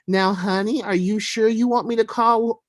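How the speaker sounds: light mock-soothing Southern mammy. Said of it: "best mammy voice".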